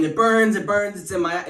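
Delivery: high, loud mock wail; acted pain cry. Brother hit in the face cries out in mock pain. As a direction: play up the sting in the eye. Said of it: "He's saying".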